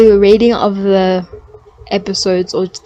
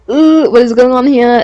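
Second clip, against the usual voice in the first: loud, high uneasy ooh; awkward ooh; 4 semitones higher, 7 dB louder than usual. Retold or imitated. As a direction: sound uncomfortable and confused. Mocking awkward sex scenes.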